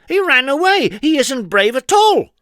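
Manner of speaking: high, loud puzzled protest; indignant cry. A narrator's reading. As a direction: protest to the crowd. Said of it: "Trouble protesting".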